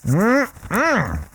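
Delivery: high rising savoring hum; delighted mm-mm. Real, not acted. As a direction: hum with pleasure at the taste. Tasting another bite.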